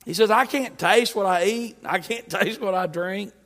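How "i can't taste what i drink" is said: ordinary talk, nothing marked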